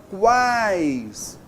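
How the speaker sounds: "quais" drawn out in one syllable; teacher's stretched demonstration of "quais" as one pulse; single-pulse triphthong. Shown as a demonstration. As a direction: glide u-a-i in "quais" without a break. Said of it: "tudo na mesma".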